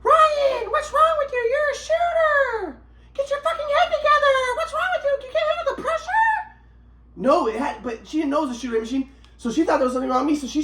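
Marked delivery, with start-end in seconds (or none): 0.0-6.6 high shrieking mock mother, laughing through it; imitating mom. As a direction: play the mom scolding him at the game, high and mocking. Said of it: "my mom was saying".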